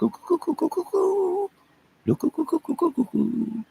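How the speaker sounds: very high piping repeated look; high squeaky look look. Acted. Streamer calls out to chat in a tiny high voice. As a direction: chirp it way up high like a cartoon.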